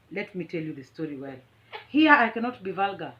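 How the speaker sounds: ordinary talk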